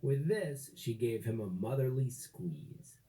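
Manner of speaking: ordinary delivery, nothing marked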